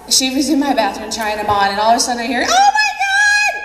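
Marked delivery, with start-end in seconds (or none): 2.4-3.5 loud high squeal, words stretched out; customer's scream. Retold or imitated. Customer trying on jeans in the bathroom, delighted scream. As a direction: shriek it long and high, as if from another room.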